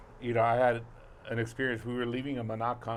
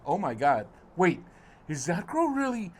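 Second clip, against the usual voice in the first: high, disbelieving gasp of a voice; wife spotting a girl peeing, shocked and high; 9 semitones higher, 4 dB louder than usual. Retold or imitated. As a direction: mimic the wife's stunned horror. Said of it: "my wife goes".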